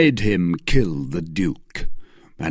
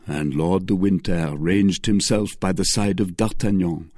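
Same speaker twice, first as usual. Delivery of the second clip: ordinary delivery, nothing marked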